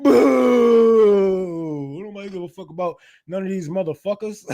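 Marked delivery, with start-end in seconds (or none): 0.0-2.0 loud mocking boo. Real, not acted. Streamer booing a chat comment, loud mocking boo. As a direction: jeer it out like a heckler.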